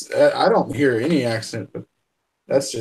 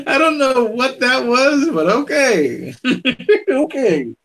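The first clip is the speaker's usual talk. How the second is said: high, amused and breaking into laughter; real laughter through slow, puzzled words; laughing; 9 semitones higher, slower than usual. Real, not acted. Parent baffled by a child's odd answer, laughing.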